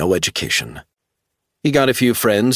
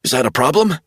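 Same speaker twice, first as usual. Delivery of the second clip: much higher, urgent pleading; desperate insistence; 8 semitones higher, faster than usual. A narrator's reading. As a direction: make him believe you. Travell voice.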